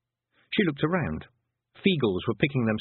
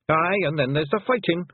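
a wee Scots fighter shrugging off death; Scots pixie warrior; 8 semitones higher, 3 dB louder than usual. A narrator's reading. Rob Anybody shrugging off death to Tiffany, high Scots pixie voice.

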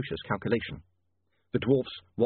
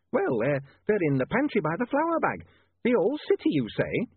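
high-pitched, faltering landlady voice; falter, then rise in wonder; flustered landlady; 9 semitones higher than usual. A narrator's reading. Landlady telling lodger where the scales are, bewildered.

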